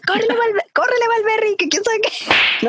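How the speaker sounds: high mock yell from the stands; dad shouting. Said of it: "me grita".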